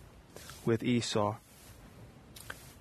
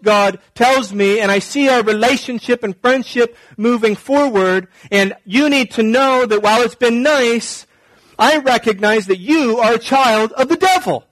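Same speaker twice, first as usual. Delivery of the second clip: loud, high-pitched holier-than-thou tirade; mock self-righteous condemnation; 7 semitones higher, 6 dB louder than usual. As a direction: denounce them with hammed-up righteous zeal. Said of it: "you don't talk like that".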